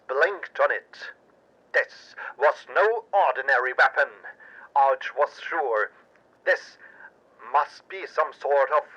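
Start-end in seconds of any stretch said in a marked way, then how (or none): none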